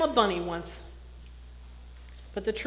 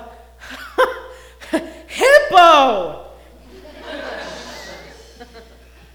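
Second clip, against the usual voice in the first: laughing burst; 4 semitones higher, 12 dB louder than usual. A narrator's reading. Rhino's name revealed, laughter.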